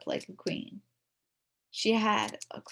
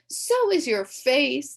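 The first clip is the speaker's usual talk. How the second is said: taunting comeback; 7 semitones higher, 8 dB louder, slower than usual. Acted. Comeback to shut her up.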